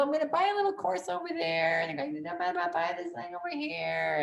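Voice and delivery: high, put-on silly voice; mock the course buyer lightly; mocking impulse buyer. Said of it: "don't waste your time".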